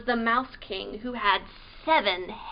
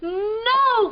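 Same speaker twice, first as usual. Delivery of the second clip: high, loud protesting cry; cried out; 9 semitones higher, 8 dB louder than usual. Acted. Marie crying out to the Mouse King in protest. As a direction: cry out to stop him. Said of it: "cried Marie".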